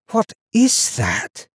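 slow, high, wondering voice; hushed-in-awe boy, pitched high; awed question. A narrator's reading. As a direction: draw it out, staring at something strange. Boy stares at gleam in ice.

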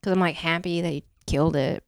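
normal talking voice

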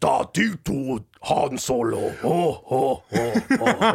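deep slow gibberish alien voice; low, slow, rumbling gibberish; Jabba the Hutt. Acted. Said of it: "Jabba the Hutt impression".

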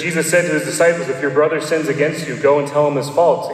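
rattled off fast, offhand recap of the verse; quick re-quote. Shown as a demonstration. Priest briskly recapping the gospel in his homily, fast. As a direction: skim through the quote quickly. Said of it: "it says".

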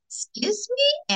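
high, slow stunned question; a pitch-leaping startled excuse me; surprised. Retold or imitated. Answering the Holy Spirit in Zumba class, shocked. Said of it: "And I was like".

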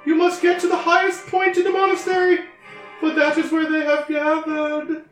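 goddess voice. Acted. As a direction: boom it down from the heavens, high and grand. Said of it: "please use your goddess voice".

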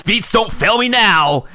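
high, loud cartoon yelp; cartoon exclamation. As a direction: squeal it like a cartoon runner. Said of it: "And he says".

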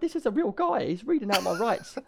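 very high astonished voice; disbelieving. Retold or imitated. Realising the cop is real, high astonished voice. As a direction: squeak it in disbelief. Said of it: "And I'm like".

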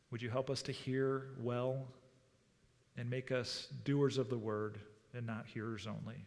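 quiet, unhurried public prayer. Real, not acted. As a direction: lead them in prayer, softening towards the amen. Leads congregation in prayer.

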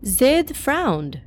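ordinary delivery, nothing marked

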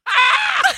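very high, loud mock scream; frustrated scream. Son-in-law realizing they are in different towns, frustrated scream. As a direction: let out a retold howl of frustration.

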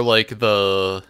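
ordinary talk, nothing marked

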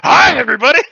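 high, loud happy-dog greeting; voicing the dog bounding into the room, overjoyed; excited dog voice. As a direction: burst in like a thrilled big dog. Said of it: "Sadie's like".

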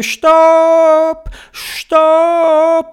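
high, loud, long-held stop calls; urgent shouting. A narrator's reading. Theobald sees a rock in the way, urgent high shout. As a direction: yell it twice, stretching each vowel. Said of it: "der Theobald hat gerufen".

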